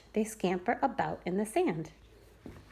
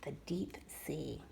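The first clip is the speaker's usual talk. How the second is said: slow hushed whisper; 5 semitones lower, 14 dB quieter, slower than usual. Acted. Reader whispering the deep sea heading, slow and hushed. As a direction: breathe it out like a secret.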